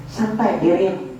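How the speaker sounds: ordinary talk, nothing marked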